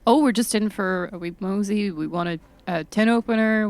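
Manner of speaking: normal talking voice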